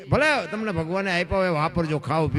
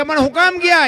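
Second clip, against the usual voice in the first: surprised husband; 9 semitones higher, 4 dB louder than usual. Retold or imitated. Husband asks why guests left.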